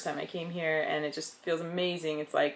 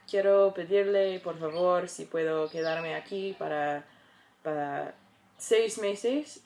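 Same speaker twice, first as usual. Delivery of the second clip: rehearsing Spanish. Retold or imitated. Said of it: "I was practicing how to say this".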